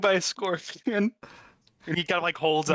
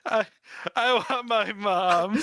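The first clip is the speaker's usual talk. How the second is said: mock crying; 4 semitones higher, slower than usual. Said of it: "He starts crying".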